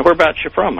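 high-pitched voicing of an older tourist; friendly tourist. American tourist greeting a fellow countryman abroad, high-pitched imitation. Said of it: "he was a tourist down there".

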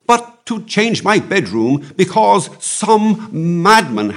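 high, loud, indignant French count; excitable French Comte. Acted. Comte objecting to changing his bedroom, high-pitched and indignant. Narrator voices the protesting Comte.

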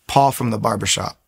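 ordinary delivery

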